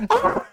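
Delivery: shrill-pitched loud scream; mock scream; high scream. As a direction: shriek in fright. Wybie scream.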